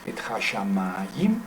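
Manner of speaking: slow "et hashamayim", long "ma", rising at the end; stress on MA. Shown as a demonstration. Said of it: "la, el acento".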